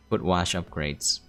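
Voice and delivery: normal talking voice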